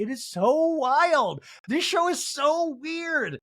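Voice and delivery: high, drawn-out amazement; incredulous, slow high squeal; amazed exclamation. Real, not acted. Host marvelling at the show.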